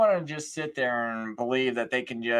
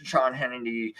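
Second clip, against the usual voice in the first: usual speaking voice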